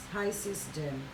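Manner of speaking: normal talking voice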